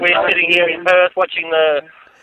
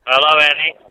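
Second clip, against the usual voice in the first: phone speaker; faster than usual. Real, not acted. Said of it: "I'll put you on speaker".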